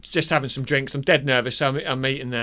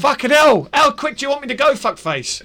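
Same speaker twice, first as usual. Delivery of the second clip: loud, high exasperated snap; fed up with the interruptions, let it out; irritated outburst; 10 semitones higher, 4 dB louder than usual. Real, not acted. Annoyed at being interrupted.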